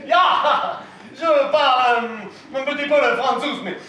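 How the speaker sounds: hammed-up German accent, high and loud; halting, fake German struggling through French; put-on German accent. Con man posing as the awaited German guest, put-on German accent. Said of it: "pourquoi pas un Germain?".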